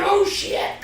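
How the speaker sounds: mock panic at a broken bottle; alarmed yelp. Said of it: "And I was like".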